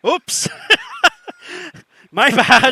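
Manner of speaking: high, loud burst of embarrassed laughter; laughing loudly. Real, not acted. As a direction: own up to a slip through real laughter. Laughs at forgetting own country.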